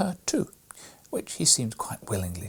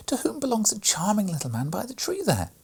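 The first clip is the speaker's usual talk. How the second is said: put-on girl's eager question; 12 semitones higher than usual. A narrator's reading. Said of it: "exclaimed Maria".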